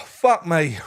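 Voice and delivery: normal talking voice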